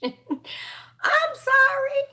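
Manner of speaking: high, drawn-out laughing sorry; laughing apology. Real, not acted. Apologizing while laughing about the name.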